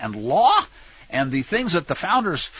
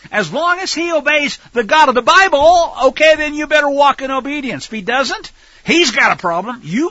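stern warning; 8 semitones higher, 7 dB louder than usual. Retold or imitated. Voicing warning about the king.

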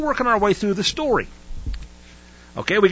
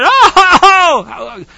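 mock wailing crowd; 12 semitones higher, 10 dB louder, much slower than usual. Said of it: "they strove and they said".

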